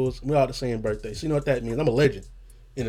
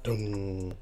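bass hit imitation; 6 semitones lower, 9 dB quieter than usual.

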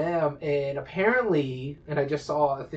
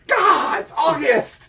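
loud, high cry of disbelief; exasperated outburst; 13 semitones higher, 8 dB louder, slower than usual. Retold or imitated. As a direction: shout it in outraged disbelief. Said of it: "I'm like".

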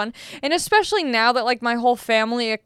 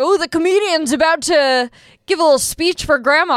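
mock people; 5 semitones higher, 5 dB louder than usual. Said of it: "everyone's like".

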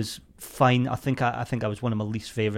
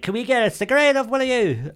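put-on girls' voice; 11 semitones higher, 4 dB louder than usual. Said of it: "they're like".

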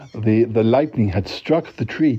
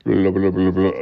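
mock brain wipe; 4 semitones lower than usual. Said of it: "I'll just wipe my brain".

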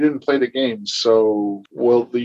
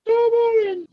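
very high squeaky put-on voice; Michael Caine impression. Shown as a demonstration. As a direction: squeak the name out in a silly voice. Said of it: "Say it like Michael Caine".

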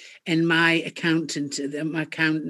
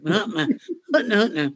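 chuckling through the words; amused, laughing denial; 4 semitones higher than usual. Real, not acted. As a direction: laugh it off as you speak. Laughing at translator joke.

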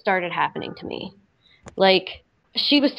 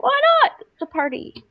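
high, carefree mock toss-off; breezy shrug. Girl at a party shrugging, careless mock tone. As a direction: lightly mimic your careless younger self.

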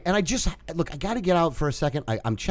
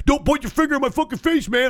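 high, pitched-up mock anger; imitating an angry neighbor; 10 semitones higher than usual. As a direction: voice the other guy's outrage, high. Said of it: "He's like".

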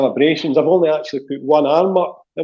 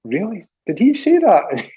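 surprised; 5 semitones higher than usual. Retold or imitated. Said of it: "looking at each other and said".

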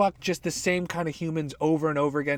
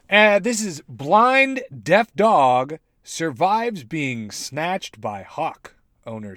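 headline read; 2 semitones higher, 7 dB louder, much slower than usual. Pretend. Reads headline aloud.

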